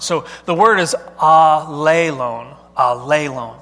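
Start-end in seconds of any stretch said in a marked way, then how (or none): 1.2-2.6 ALELON stretched slowly, syllable by syllable with a heavy middle; stressed Greek pronunciation. Shown as a demonstration. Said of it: "put the emphasis on it". 2.8-3.5 "alelon" repeated as ah-LAY-lone, voice dropping; Greek pronunciation demo. Shown as a demonstration. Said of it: "put the emphasis on it".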